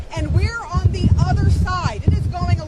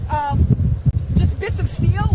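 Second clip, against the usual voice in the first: normal talking voice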